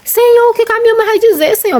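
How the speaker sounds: crying plea. Retold or imitated. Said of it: "eu comecei a chorar". (súplica chorosa, bem mais aguda)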